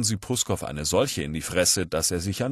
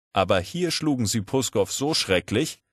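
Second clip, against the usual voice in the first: ordinary delivery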